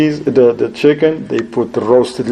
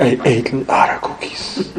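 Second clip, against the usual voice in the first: laughing near whisper; 2 semitones lower, 5 dB quieter than usual. Retold or imitated. Guest admits, speaker laughing.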